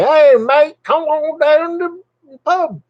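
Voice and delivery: high-pitched, loud mock Cockney; Cockney accent. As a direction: put on a squeaky, hammed-up Cockney pub call. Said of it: "not like it was a Cockney".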